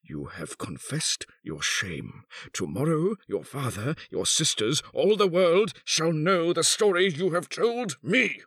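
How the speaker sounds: swings from controlled to high outburst; furious threat. A narrator's reading. Husband threatens to expose his wife, furiously. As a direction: threaten her, building to a high climax. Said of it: "he cried in sudden fury".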